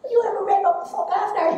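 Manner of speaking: mother teasing son, high and fast; high fast Donegal mother voice. Retold or imitated. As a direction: rattle it off high in her Donegal accent. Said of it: "full accent".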